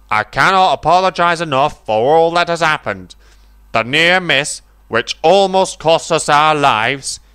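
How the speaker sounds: the railway boss owning up in public; stately apology. A narrator's reading. Fat Controller apologizes to the crowd, formal.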